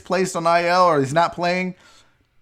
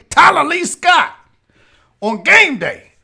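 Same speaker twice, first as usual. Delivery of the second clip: high, loud Southern colonel voice; Southern aristocrat; 8 semitones higher, 5 dB louder than usual. Pretend. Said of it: "it's actually the voice I used to do when I would tease".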